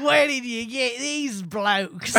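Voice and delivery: hammed-up Australian, slow and laughing; mock Australian accent. Friends joking about a movie villain, mock Australian accent. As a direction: lay on a jokey Australian accent. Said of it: "that's how an Australian sounds".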